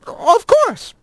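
very high, prim; a polite lizard. A narrator's reading. Lizard agrees.

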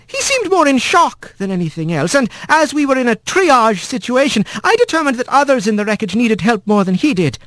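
high polite nurse wren; eager little bird witness. A narrator's reading.

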